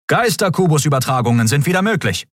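high, formal announcement; announcing officer. A narrator's reading. Communications officer announcing to the crew, high and formal. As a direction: announce it to the bridge.

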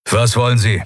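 curt, gruff question. A narrator's reading. Admiral curtly demanding what the empress wants, gruff. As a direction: bite it off curtly. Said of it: "fragte Telios schroff".

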